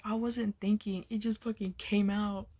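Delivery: ordinary talk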